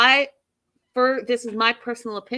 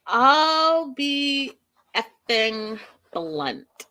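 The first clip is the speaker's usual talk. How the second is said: slow, higher, deliberate dad voice; mimicking blunt dad; 6 semitones higher, 3 dB louder, much slower than usual. Vlogger voicing the angry dad's blunt opening, slow and higher. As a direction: spell it out slowly like an exasperated father.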